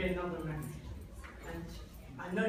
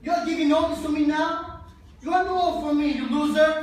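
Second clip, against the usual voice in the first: much higher, loud insults hurled; furious shout; 9 semitones higher, 11 dB louder than usual. Acted. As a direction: spit it at her with contempt. Father yelling at the mother.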